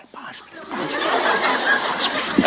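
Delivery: soft, slowed, breathy laughing plea; child stalling. Acted. Child playing outside putting off his mother, softly and slowly. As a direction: stall her lazily, laughing.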